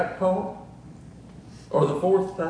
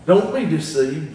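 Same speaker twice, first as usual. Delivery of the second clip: normal talking voice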